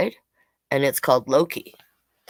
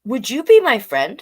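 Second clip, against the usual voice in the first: calling out high and loud to the frog; called out; 8 semitones higher, 7 dB louder than usual. Acted. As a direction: call across the pond hopefully. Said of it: "Field Mouse called out".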